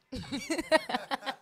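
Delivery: loud open laugh breaking out; burst of laughter. Real, not acted. Telling friends he woke in a wet bed, bursting out laughing. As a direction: laugh hard at your own story.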